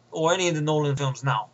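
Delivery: normal talking voice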